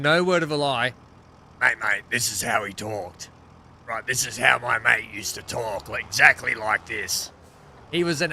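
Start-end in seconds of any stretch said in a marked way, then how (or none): 1.6-7.3 imitating a late mate's distinctive way of talking; Fats's distinctive voice. Streamer showing how his late mate talked, affectionate impression. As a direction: put on an old mate's voice, all the way through. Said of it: "had a very distinctive accent".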